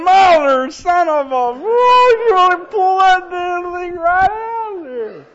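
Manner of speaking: high, loud, drawn-out bellow; comic hollering. Retold or imitated. Imitating the guy at the pump, exasperated mock hollering. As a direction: holler it, stretching the last words long. Tow truck driver hollering at him.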